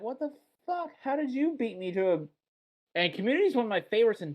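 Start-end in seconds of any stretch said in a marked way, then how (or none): 0.0-2.2 high-pitched incredulous reaction; retold disbelief. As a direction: act out baffled disbelief. Retelling own disbelief at being beaten.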